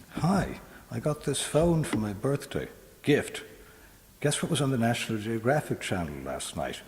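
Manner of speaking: put-on high, bright gushing girl on phone; chatty teenage girl. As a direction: rattle it off like a teen on her mobile. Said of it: "here we go, Girl on Mobile".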